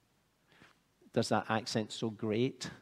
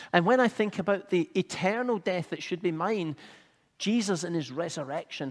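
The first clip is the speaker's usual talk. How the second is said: higher and louder fervent preaching; impassioned preaching; 6 semitones higher, 5 dB louder than usual. Real, not acted. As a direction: preach it out with full conviction. Sermon climbs to its fervent peak.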